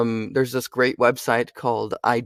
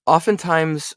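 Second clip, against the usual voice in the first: ordinary talk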